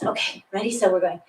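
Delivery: ordinary talk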